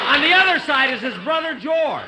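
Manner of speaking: shocked realization. Acted. [name] spots [name] on the phony bill, shocked. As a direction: blurt it out in disbelief.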